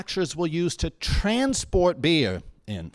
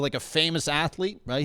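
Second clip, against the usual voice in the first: usual speaking voice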